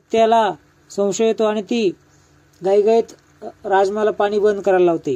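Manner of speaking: ordinary delivery, nothing marked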